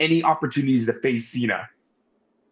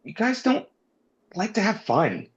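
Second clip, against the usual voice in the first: hurt and pouty, much higher and halting; mock-sad whine; 7 semitones higher than usual. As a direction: replay being sad in a pitched-up little voice. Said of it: "I was genuinely sad".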